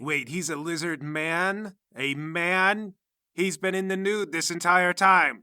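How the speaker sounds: slow disbelief building to loud outrage; scandalized disbelief. Acted. Startled to learn he is male.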